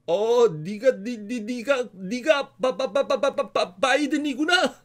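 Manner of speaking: mocking stammer on 네가 and 바-; a teacher mimicking a child's stutter to tease him; nun mocking the stutter. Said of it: "그걸 어떻게 좀 따라했나 봐요".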